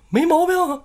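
very high, loud protest; comic high-pitched exclamation; breezy mock reassurance. Ghost husband brushes off wife's shock.

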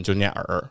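耳 said low and dipping; third tone er. Shown as a demonstration. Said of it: "你耳的话".

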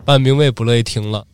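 ordinary delivery

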